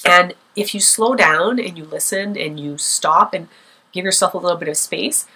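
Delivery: usual speaking voice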